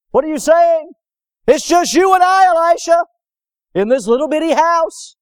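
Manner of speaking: high, loud, incredulous servant; alarmed disbelief. Acted. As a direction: protest in acted panic. Servant protests they are outnumbered.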